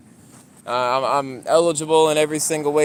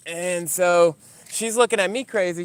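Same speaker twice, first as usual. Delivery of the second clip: normal talking voice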